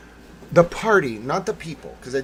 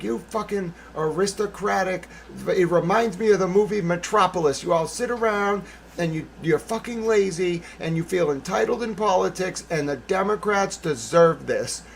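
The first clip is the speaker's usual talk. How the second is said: higher, louder scolding; retold angry rant; 6 semitones higher, 5 dB louder than usual. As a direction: replay a heated telling-off. Retold telling-off of the bundler.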